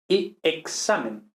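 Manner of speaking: examen with a clear KS, slowly. Shown as a demonstration. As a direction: stretch the KS in examen. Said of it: "X siempre K S".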